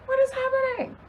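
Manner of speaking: very high, softened retold disbelief; small high-pitched "what's going on" reaction; mock bewilderment. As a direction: squeak it quietly like a stunned bystander. Retold stunned reaction to screaming.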